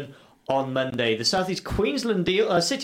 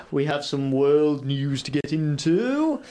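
slow deep newsreader voice with vibrato; 3 semitones lower, slower than usual. Pretend. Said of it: "Nice deep vibrato there".

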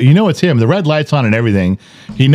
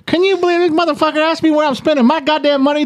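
high, hammed-up indignant customer; pitched way up, mock outrage; mock outraged rant; 11 semitones higher than usual. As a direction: play it up as a cartoon of fury at the cops. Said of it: "I'm gonna go".

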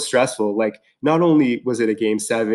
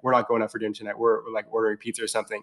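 stressed, subdued; 6 dB quieter, faster than usual. Retold or imitated. Stressed fan to partner during the game, subdued. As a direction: retell a tense couple quietly backing out of plans. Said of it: "we were so stressed".

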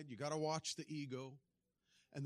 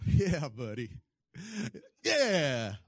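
smug self-praise; 9 dB louder, much slower than usual. Retold or imitated. Self-praise after pastor's approval.

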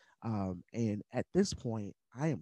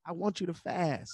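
higher and more pressing, repeating the order; pastor insisting; 8 semitones higher than usual. Retold or imitated. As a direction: insist again, more urgently.

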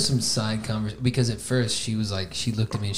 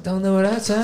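high, loud, put-on Australian voice; loud hammed-up Australian accent; 9 semitones higher, 6 dB louder than usual. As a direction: ham up a friendly Australian at high pitch. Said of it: "just come in with an accent".

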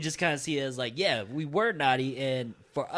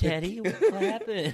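put-on higher, hesitant kid question; mock confused child; 7 semitones higher than usual. Kid questions gangster dad, mock bewilderment. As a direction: lightly play a bewildered little kid.

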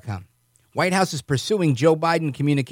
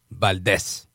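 much lower, terse single word; a territorial old man giving only his name; curt alpha greeting; 11 semitones lower than usual. Retold or imitated. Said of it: "And he'd say".

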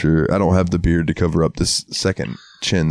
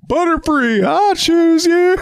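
much higher, slow mock cry; high mock Hank call; slower than usual. Quoting Hank Hill throwing Butterfree, high and slow.

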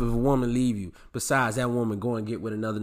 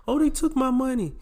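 high mock whine of the ex, much higher; crying ex imitation; 13 semitones higher than usual. Ex crying to him about the lost money, high mock whine.

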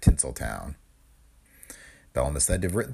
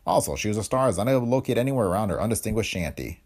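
plain reading voice

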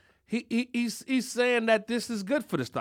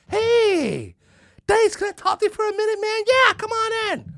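much higher, cheery put-on voice; mock friendly boss; 7 dB louder than usual. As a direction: sing it out like a too-nice boss. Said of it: "It's never like".

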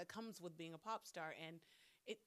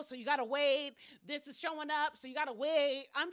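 higher, louder venting; pissed-off rant; 6 semitones higher, 9 dB louder than usual. Real, not acted. Solo podcaster venting at viewers, angry and louder. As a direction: vent every annoyance in a row. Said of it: "I'm getting so pissed".

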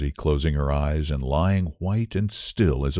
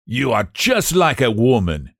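put-on a mild yell in a German accent; 4 semitones higher than usual. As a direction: bark it like a cranky old German. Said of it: "yelled Behrman".

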